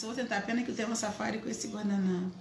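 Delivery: usual speaking voice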